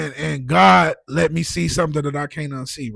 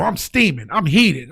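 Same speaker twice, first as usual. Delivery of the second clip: usual speaking voice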